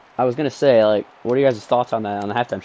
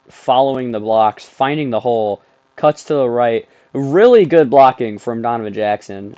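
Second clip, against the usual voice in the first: announcer breakdown; 6 dB louder than usual. Pretend.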